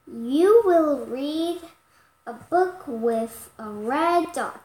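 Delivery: ordinary delivery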